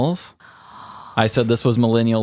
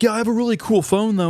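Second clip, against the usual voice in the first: much higher, smug mock voice; mock boastful weirdo; 12 semitones higher, faster than usual. Weird coworker bragging about his phone, high mock voice. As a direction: show off the phone like a clueless oddball.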